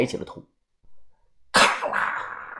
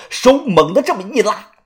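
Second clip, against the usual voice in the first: plain reading voice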